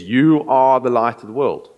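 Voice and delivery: "You" punched loud at the start; preacher's emphatic quote. Retold or imitated. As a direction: land hard on "You". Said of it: "this emphatic sense of saying".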